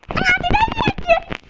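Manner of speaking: very high slow squeaky voice. Pretend. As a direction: squeak it out comically. Squeaky funny voice.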